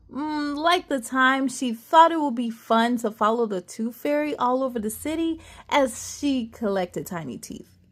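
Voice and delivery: ordinary delivery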